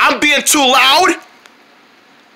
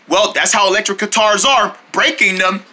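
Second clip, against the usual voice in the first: ordinary talk, nothing marked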